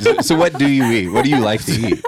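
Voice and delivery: giggling in disbelief; laughing. Real, not acted. Amused reaction to guest's answer.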